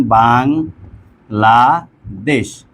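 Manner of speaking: "Bangladesh" broken as bang, la, desh with pauses; slow, stretched syllables in "Bangladesh"; split into syllables. Shown as a demonstration. Teacher breaking a word up for students, "Bangladesh" syllable by syllable. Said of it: "শব্দটা আমরা যদি একটু ভাঙি".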